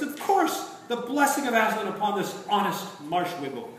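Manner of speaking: breathless gladness at remembering; joyful prince. A narrator's reading. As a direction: prince shaking off the enchantment. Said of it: "cried the prince".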